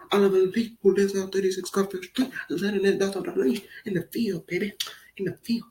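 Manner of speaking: high tattletale voice; mock tattling. Neighbor snitching on Oliver's dogs, high mock tattle.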